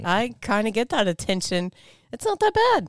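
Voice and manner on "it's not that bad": mock modest. Joking about attention.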